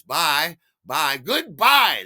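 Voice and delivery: loud, high curt phone goodbye, retold; angry sign-off. Michael ending a phone call before slamming it down, angry sign-off. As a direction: act out a loud, exasperated hang-up.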